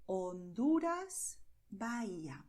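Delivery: "Honduras" and "Bahía" with no H sound, Spanish style; silent H. Shown as a demonstration. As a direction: slowly model "Honduras" as on-DOO-ras, "Bahía" as ba-EE-a. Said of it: "but you do not pronounce it".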